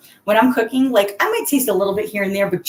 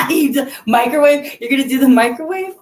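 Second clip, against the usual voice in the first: amused disbelief; 7 semitones higher, 4 dB louder than usual. Real, not acted. Reacting to a viewer who microwaves corn dogs, amused disbelief. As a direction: pitch up in playful shock at the idea.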